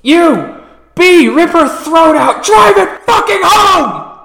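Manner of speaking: high, building, furious shout; shouted command. Acted. Game master as a villain ordering an attack, building to a full shout. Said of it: "Or he goes".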